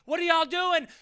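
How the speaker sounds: high calling mother's voice; running mom pleading them to stop. Retold or imitated. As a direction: cry out while running over to stop them. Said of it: "running across the field saying".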